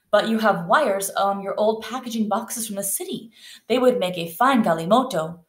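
expressive read-aloud with lifted dialogue; lively storybook reading; story narration. A narrator's reading. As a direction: read a picture book to children, voicing the characters. Reads story aloud to class.

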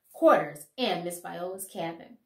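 ordinary delivery

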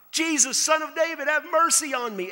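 blind beggar shouting high and loud for help; crying out. Acted. Blind Bartimaeus calling to Jesus from the roadside, loud desperate cry. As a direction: call out across a crowd, desperate. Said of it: "And he cries out".

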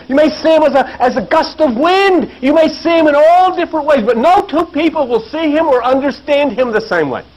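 high-pitched, insistent sermon cadence; fervent preacher building a list; impassioned preaching. Real, not acted.

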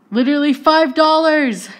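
loud, high, thrilled; amazed exclamation. Real, not acted. Vlogger marvelling at the cheap price, loud excited shout.

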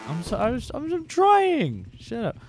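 high-pitched, drawn-out plea on "trying"; hammed-up flustered self-defence; mock exasperated protest. Tourist caught trying Finnish in a shop, mock exasperation.

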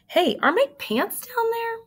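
calling down. Acted.

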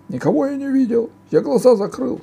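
very high, loud ogre voice; frantic ogre. A narrator's reading. Ogre defending himself against the girl, high yelled denial. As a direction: deny it in a high-pitched yell.

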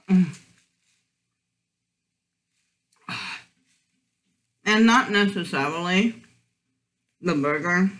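talking with food in mouth; speaking between bites; chewing. Real, not acted. Seen 2: bites food just before; closed-lip chewing motions between words.

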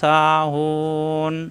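slow, drawn-out chanted recitation; Quran recitation. Shown as a demonstration. Teacher reciting Quran verse for students, slow chant. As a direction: recite with long tajweed stretches.

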